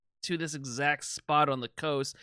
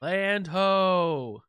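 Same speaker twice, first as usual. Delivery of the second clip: long-drawn sailor's lookout call; drawn-out call from the deck. Acted. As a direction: sing it out across the water. Sailor calling out land sighted.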